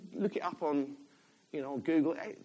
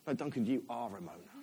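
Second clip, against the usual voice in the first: lower, softer, matter-of-fact rebuke; God's quiet correction; 7 semitones lower, 8 dB quieter than usual. Retold or imitated. God telling the preacher he moans, quiet correction. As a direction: correct him quietly and firmly. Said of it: "God said to me".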